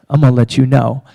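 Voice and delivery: booming low mock voice; mock eager student. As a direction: overeager kid shouting. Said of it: "a bunch of you are like".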